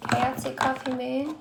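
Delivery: normal talking voice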